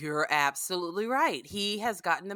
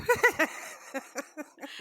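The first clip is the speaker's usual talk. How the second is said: laugh; 9 semitones higher than usual. Real, not acted. Laughing at own picture.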